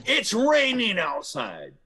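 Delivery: high, slow, loud yell of a weather fact; mock angry shout of nothing; mock scream. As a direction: yell it like it's news. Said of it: "and I scream".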